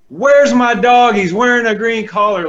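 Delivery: an owner fiercely demanding his stolen dog back; super intense. Retold or imitated. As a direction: confront the neighbours hard about the missing dog. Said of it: "like, super intense".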